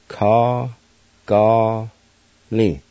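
"Ka Ga Lin" as three isolated syllables, long pauses between; syllable-by-syllable pronunciation. Shown as a demonstration. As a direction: sound out "Ka Ga Lin" one syllable at a time. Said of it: "It's pronounced like this".

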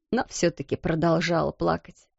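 usual speaking voice

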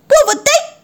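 put-on very high toddler squeak; demanding baby puppy. (требовательный детский писк)

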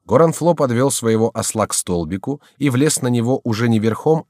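plain reading voice

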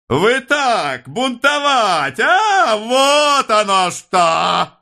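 very high, drawn-out hysterical squeal; breaking shriek. A narrator's reading. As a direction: shriek slowly until the voice nearly breaks. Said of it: "Голос у него вздрогнул, взвизгнул". (срывающийся истеричный визг)

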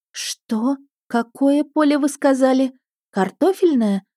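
disbelieving, heart sinking at Картофельное; crestfallen disbelief. A narrator's reading. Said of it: "упавшим голосом переспросила я".

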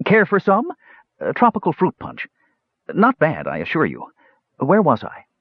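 high, breezy host; dapper young man. Acted. Dapper young man offering Palin fruit punch, airy. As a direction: offer it airily, then lose the thread.

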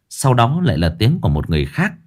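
usual speaking voice

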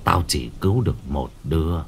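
put-on low, quiet, slightly trembling old man; deep-voiced shaman. Shaman telling the mother to choose, low and trembling. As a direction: say it low and cold, a tremor underneath. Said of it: "Lão Mo trầm giọng".

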